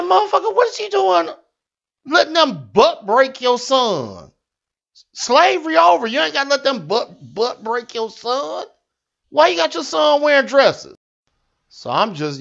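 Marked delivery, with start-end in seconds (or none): 0.0-11.0 high, outraged mock rant; retold outburst. Comic telling Will about his son, in high outraged disbelief. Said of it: "I said".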